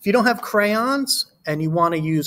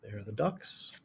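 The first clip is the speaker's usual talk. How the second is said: ordinary delivery, nothing marked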